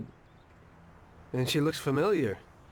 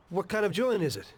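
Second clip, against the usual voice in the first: ordinary delivery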